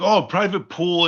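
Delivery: high-pitched sarcastic delight; lifted, fake-impressed quote; mock impressed. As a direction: marvel mockingly at the advertised luxury. Mocking the brochure's promise.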